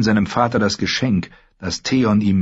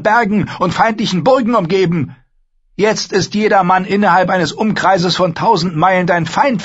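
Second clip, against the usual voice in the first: much higher, louder sister lecturing her brother; Asha, cool and scornful; 12 semitones higher, 5 dB louder than usual. Acted. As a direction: talk down to him, sharp and sure.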